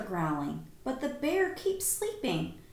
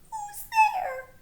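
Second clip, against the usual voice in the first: tiny squeak, much higher and louder; mouse cries out; 5 dB louder than usual. Acted. A startled mouse calling into the dark cave, squeaky. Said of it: "Mouse cries".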